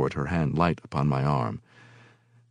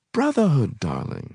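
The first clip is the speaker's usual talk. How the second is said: coy woman; 8 semitones higher than usual. A narrator's reading.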